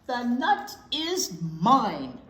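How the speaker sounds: slow, loud and insistent; stubborn claim. Acted. Mouse and chipmunk fighting over the nut, loud and slow. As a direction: drag each word out to stake your claim.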